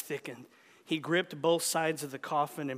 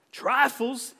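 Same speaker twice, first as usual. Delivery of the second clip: hissed; 9 semitones higher, 6 dB louder than usual. Acted. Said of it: "his uncle hissed".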